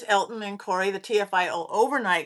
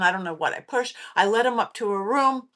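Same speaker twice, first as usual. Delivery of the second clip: ordinary talk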